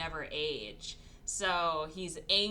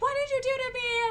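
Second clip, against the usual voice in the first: much higher, a bit louder, furious; mock angry scream; 6 dB louder than usual. Woman confronting him by the pool, a mock furious scream. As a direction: yell it at the man who trapped her. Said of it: "screaming at him".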